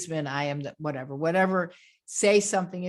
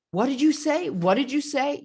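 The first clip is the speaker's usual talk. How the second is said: high, rising, insistent question; disbelieving question; 8 semitones higher, 4 dB louder than usual. Acted. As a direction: ask it as if you cannot take it in. Acts shocked relative asking repeatedly.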